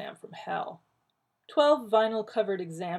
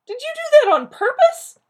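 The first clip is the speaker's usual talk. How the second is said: much higher and louder, cracking with embarrassment; voice soaring and cracking; 17 dB louder than usual. Acted. Rodney accusing John, voice soaring and cracking. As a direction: let the voice shoot up and break. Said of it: "Rodney's voice soared and cracked".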